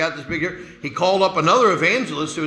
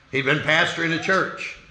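usual speaking voice

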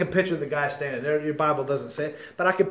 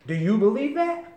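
usual speaking voice

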